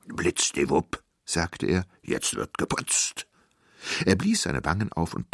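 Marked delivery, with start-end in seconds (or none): 0.1-1.0 lowered giant voice; big giant pronouncing a spell; giant's magic word. A narrator's reading. 2.0-3.3 deep, slow giant voice; ponderous giant delivery; giant announcing. A narrator's reading.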